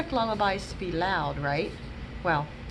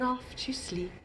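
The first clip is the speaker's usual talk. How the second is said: whispered drift off; 3 semitones higher, 10 dB quieter than usual. Acted. Lullaby line drifting off.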